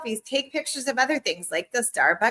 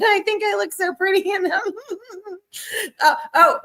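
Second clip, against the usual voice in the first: laughing; 10 semitones higher, 3 dB louder than usual. Real, not acted. Laughs at her own selfies.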